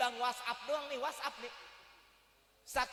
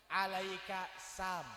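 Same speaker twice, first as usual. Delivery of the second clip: slow deliberate reply; 3 semitones lower, much slower than usual. Shown as a demonstration. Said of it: "apa jawabnya?".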